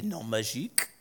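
imitated French pronunciation of "non-magique"; French accent. Quoting Grindelwald's rally speech, French accent. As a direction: drop into a French accent for the villain's word. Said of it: "pronounces it with a French accent".

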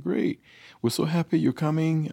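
high-pitched imitation of a delighted wife; imitating a cheery wife; wife's happy reply. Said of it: "So I called my wife up".

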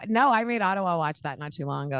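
ordinary talk

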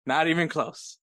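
usual speaking voice

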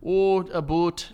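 slow mock Canadian about; Canadian accent. Answering what a Canadian accent is: slow mock Canadian. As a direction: stretch it slowly, Canadian style. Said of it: "What's a Canadian accent?".

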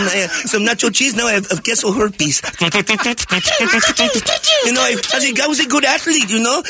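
high-pitched, put-on hacky comic voice; high, insistent clowning voice; punchline comic character. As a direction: sell silly jokes in character. Clowning as a joke-teller with punchlines.

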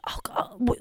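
breathy hesitant whisper. Retold or imitated. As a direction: whisper it like giving in. Mom voiced in story.

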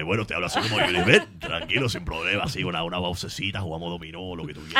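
low-pitched, laid-back Cuban accent; easygoing Cuban, voice set low. Shown as a demonstration. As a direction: drop into a deep Cuban voice.

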